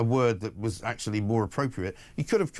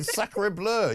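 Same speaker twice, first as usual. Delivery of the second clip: put-on French farce accent; 8 semitones higher than usual. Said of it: "it's like a French farce".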